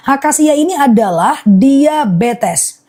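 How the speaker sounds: slow, deliberate listing with pauses; slowly repeated. Real, not acted. Seller repeats the honey's uses for live viewers, slow and deliberate. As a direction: spell it out slowly so every viewer catches it. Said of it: "aku ulang akasia fokus ya".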